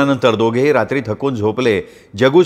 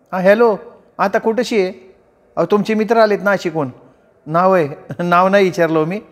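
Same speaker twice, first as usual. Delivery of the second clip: higher rustic wife's voice on the phone; village wife on phone; 7 semitones higher than usual. Acted. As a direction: talk up brightly like a country housewife. (फोनवर बोलणारी गावरान बायको, उंच आवाज)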